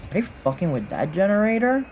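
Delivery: ordinary delivery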